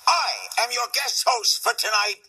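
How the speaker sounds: high, loud announcer voice of Larry King; Larry King impression. The guest pretends to open the show as Larry King, loud announcer voice. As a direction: boom it out like the host opening the show. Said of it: "guest host as Larry King".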